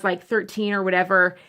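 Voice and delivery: normal talking voice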